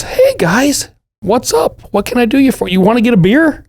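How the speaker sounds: high, cheery, friendly voice; jolly Fedor. Retold or imitated. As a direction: greet old drinking buddies warmly and brightly. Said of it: "He says".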